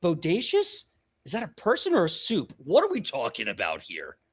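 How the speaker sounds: halting, then high incredulous questions; acted flustered confusion; confused and overstimulated. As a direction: splutter in bewilderment, pitch leaping up. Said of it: "so confused and overstimulated already. She's like".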